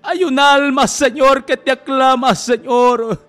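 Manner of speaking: high, loud, impassioned appeal; crying out in prayer. Real, not acted. Telling God of a soul calling him, loud fervent cry. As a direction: cry it out to heaven with full force.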